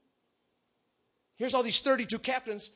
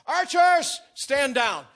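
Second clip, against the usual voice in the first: loud military order, high at first; commanding shout; 6 semitones higher, 11 dB louder, much slower than usual. Acted. Captain calling off his archers, a shouted command. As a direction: call the troops off at full voice.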